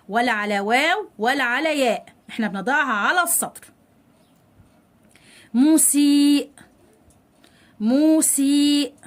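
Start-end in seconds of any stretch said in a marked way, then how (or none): 5.5-8.9 louder, long held vowels; drawn-out model word. Shown as a demonstration. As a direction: dictate it very slowly to pupils. Teacher models example word twice. (نطق ممدود للكلمة)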